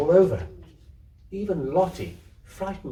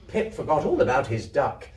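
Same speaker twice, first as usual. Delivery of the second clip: ordinary delivery, nothing marked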